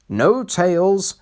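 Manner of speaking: slow firm order; old engine putting his foot down. A narrator's reading. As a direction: cut them off firmly. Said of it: "said Skarloey firmly".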